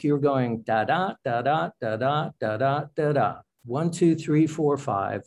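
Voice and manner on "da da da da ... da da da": da DA beats, every second da stressed; steady demonstration of iambs, weak da then strong da; iambic rhythm. Shown as a demonstration. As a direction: chant da da in five even unstressed-stressed pairs. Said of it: "when you write in iambic".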